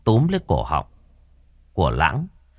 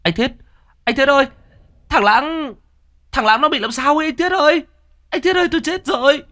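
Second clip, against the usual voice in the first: much higher, louder panicked yell; yelling for help; 6 dB louder than usual. A narrator's reading. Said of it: "thằng Nam hét lên".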